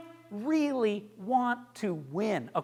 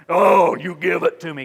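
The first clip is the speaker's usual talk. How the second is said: loud condescending demand; looking down, demanding; 10 dB louder than usual. Shown as a demonstration. Coach mocks a pitcher looking down on judges, loud and bossy. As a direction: talk down to them. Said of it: "you don't wanna be looking down at 'em".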